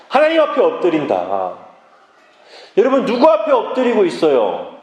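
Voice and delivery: high-pitched, forceful sermon delivery; impassioned preaching. Real, not acted. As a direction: preach it out, driving each phrase home. Preaching builds to full force.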